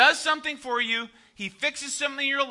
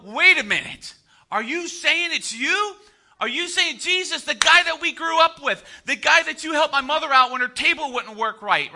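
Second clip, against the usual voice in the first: high-pitched mock disbelief; incredulous townsfolk; 5 semitones higher, 5 dB louder than usual. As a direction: question it as an astonished neighbour.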